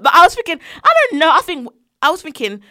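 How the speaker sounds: usual speaking voice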